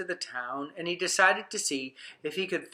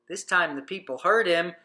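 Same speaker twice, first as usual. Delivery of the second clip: plain reading voice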